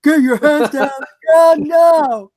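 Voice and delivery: loud, slow police bark; hammed-up cop shout; barked command. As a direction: bark it like an officer.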